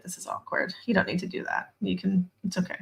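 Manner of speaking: hushed, awkward aside; awkward whisper. Retold or imitated. Whispering awkwardly to her mom while she scrubs. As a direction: whisper it so mom can't hear.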